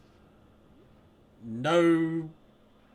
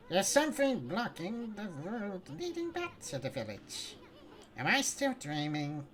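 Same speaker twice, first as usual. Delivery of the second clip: character voice; 8 semitones higher than usual. Acted. Voicing character's line.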